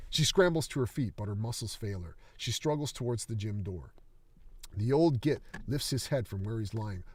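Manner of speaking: ordinary delivery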